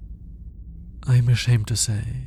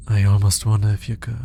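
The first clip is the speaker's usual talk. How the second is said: ordinary delivery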